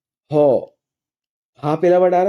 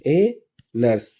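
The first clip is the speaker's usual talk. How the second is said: "ए nurse" drawn out slowly, each word read apart; slow clear reading; much slower than usual. Shown as a demonstration. Teacher reading the exercise word slowly to pupils, drawn out. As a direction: spell it out slowly for the class. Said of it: "क्या लिखा हुआ है".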